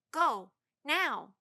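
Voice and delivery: loud, firm command. A narrator's reading. Annie orders Tad to leave, firm and loud. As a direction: boss him out. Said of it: "Annie said firmly".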